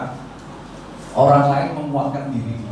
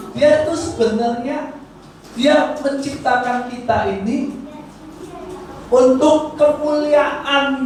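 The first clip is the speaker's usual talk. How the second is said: higher, slow, drawn-out sermon voice; full preaching; 9 semitones higher than usual. Acted. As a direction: stretch each phrase to lift the hall.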